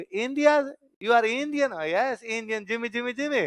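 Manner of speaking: excited Russian greeting. Retold or imitated. Russian cab drivers greeting.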